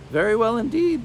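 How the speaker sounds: put-on high, strained old hag croak; lightly croaked crone reply. A narrator's reading. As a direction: croak it like a sly witch. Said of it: "the beldame croaked".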